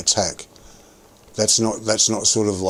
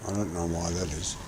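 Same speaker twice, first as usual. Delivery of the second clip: usual speaking voice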